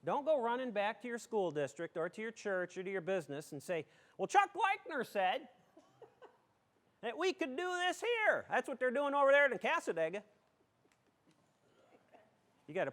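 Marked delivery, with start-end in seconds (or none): 4.2-10.2 high-pitched, hammed-up eager townsperson; mock excited report. Excited attendee reporting back to the school district, hammed-up enthusiasm.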